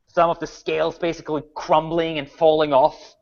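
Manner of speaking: ordinary talk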